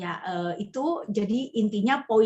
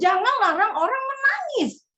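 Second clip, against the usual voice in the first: forceful rebuke; 12 semitones higher, 5 dB louder than usual. Retold or imitated. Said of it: "Saya bilang".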